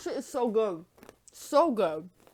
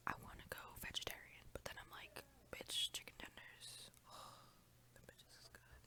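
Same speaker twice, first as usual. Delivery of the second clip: hushed unvoiced storytelling; whisper; 13 dB quieter than usual. Real, not acted. Whispering to viewers about cake and chicken tenders. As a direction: keep it all in a soft whisper. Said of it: "Oh, good, I just have to speak".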